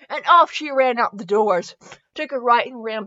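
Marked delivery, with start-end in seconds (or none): none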